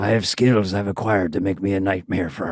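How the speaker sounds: low action-hero threat voice; Taken speech impression. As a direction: imitate a movie avenger's low, measured threat. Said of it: "that movie Taken".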